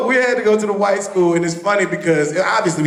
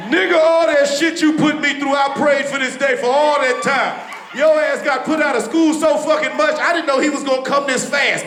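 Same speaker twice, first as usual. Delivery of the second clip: gloating dad; 7 semitones higher than usual. Retold or imitated. Dad gloating over phone.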